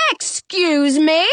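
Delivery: slow, high, indignant challenge; outraged talking mirror; offended yell. A narrator's reading. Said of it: "the mirror yells".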